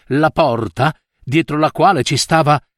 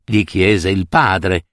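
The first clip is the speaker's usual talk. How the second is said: ordinary delivery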